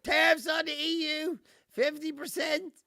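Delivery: mock Trump announcement. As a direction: bark it like a blustering president. Acting Trump announcing tariffs.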